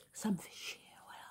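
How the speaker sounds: a muttered whisper under the breath; whispered swearing. Real, not acted. Speaker to viewers, whispering a curse. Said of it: "je dis des gros mots".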